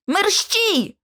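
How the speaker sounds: high-pitched, urgent cry; hoarse urging. A narrator's reading. George hurrying the others under threat, hoarse and urgent. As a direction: urge them to hurry, alarmed. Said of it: "хрипко промовив він".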